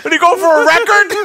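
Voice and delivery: high laughing disbelief; shrieking with laughter, can't believe it; hysterical laugh. Real, not acted. Friend watches repeated deaths.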